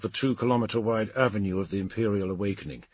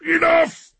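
high, loud outburst; strained yell of surrender; desperate shout; 8 dB louder than usual. Acted. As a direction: cry out to make it stop. Rantal yields, throwing up hands.

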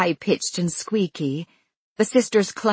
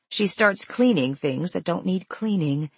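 usual speaking voice

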